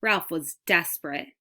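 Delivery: usual speaking voice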